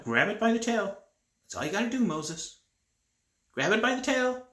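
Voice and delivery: high, loud, insistent coaxing; coaxing God. Acted. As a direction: urge him on, pitch rising with each command. God coaxes a scared Moses.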